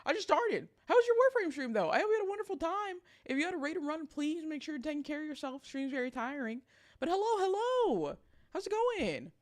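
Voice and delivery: high, bright raid welcome; hyped streamer greeting. Acted. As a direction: pump up the new viewers. Greets raiders in high host voice.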